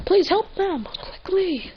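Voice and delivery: high, anxious acted plea; worried story-character voice; urgent pleading. Pleading for help.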